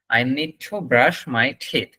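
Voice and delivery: "teeth" said slowly with a clear ঠ-like t; slow demo, ঠ-like t in "teeth"; careful pronunciation. Shown as a demonstration. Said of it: "ঠর উচ্চারণ হয়, ঠ".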